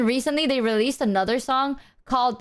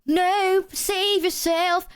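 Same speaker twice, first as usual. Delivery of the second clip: much higher, slow plea; dramatic high plea; 9 semitones higher, much slower than usual. Retold or imitated. Her part in the mock edgy song, pleading. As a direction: cry out like a melodramatic duet. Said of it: "you come into the song and you go".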